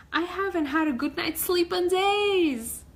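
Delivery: high, loud crabby grumble; crabby complaint. Acted. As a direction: whine it like a grumpy crab.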